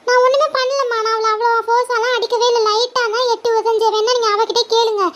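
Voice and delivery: put-on a whiny kid insisting she did nothing; little girl pleading she only kicked lightly; defensive little sister. Kid sister denies to mother.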